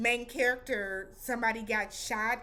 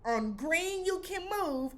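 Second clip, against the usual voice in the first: high, emphatic robot announcer; hammed-up little robot reading rules; 3 semitones higher, 4 dB louder than usual. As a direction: recite the rules in a high-pitched doll-robot voice. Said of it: "the little robot was like".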